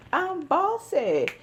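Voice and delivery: put-on high, slow, cute toddler voice with a giggle; baby voice. Voicing the baby saying she's bossy, high and cute. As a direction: coo like a bossy little toddler.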